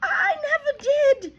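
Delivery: put-on terrified boy protests; exclaimed. A narrator's reading. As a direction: cry out in panic. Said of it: "the boy exclaimed".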